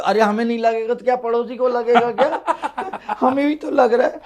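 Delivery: exasperated husband. Acted.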